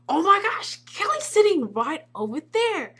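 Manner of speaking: high, loud, stretched-out amazement; thrilled gasping fan. Retold or imitated. Fan spotting Kelly sitting nearby, high excited gushing. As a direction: gush in high disbelief at spotting an idol.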